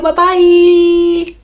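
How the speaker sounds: high, loud sing-song bye; bubbly high farewell to the viewers; cheery goodbye. Real, not acted. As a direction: wave them off brightly.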